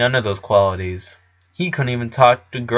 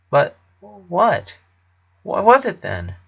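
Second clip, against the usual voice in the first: anxious; 8 semitones higher, slower than usual. A narrator's reading. Clyde anxiously pressing Lincoln.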